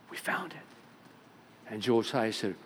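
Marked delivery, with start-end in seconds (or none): 0.1-0.6 whispered, awed report of a find; hushed discovery. Acted. Messengers tell the king about the lost book, hushed. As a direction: say it under your breath, almost whispering.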